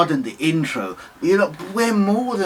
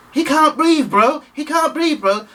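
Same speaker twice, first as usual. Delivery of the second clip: high, louder pleading; raised, insistent bystander's protest; urgent bystander plea; 10 semitones higher, 4 dB louder than usual. Retold or imitated. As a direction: plead urgently on someone's behalf. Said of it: "moved forward and said".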